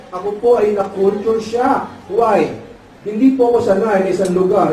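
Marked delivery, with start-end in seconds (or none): none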